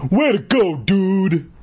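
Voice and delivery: high, hearty congratulation; grinning cheer. A narrator's reading. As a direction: cheer a buddy on with a grin. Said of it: "Moose grinned".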